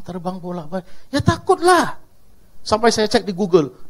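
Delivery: ordinary talk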